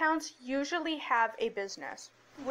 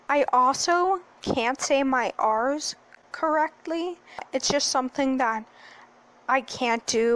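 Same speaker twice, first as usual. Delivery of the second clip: ordinary talk, nothing marked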